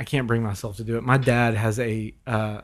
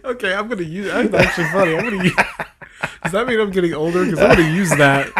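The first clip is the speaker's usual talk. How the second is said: laughing; 5 semitones higher, 4 dB louder, faster than usual. Real, not acted. Laughing at Fiji joke.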